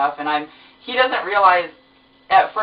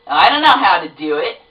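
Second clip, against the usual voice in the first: disgusted clerk; 6 dB louder than usual. Retold or imitated. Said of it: "the most disgusted look".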